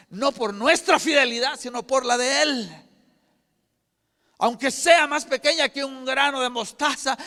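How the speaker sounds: loud, high, ringing preacher's cry; preaching at full force. Acted. Preacher rallying the congregation, loud and high. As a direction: build the faith call up to a shout.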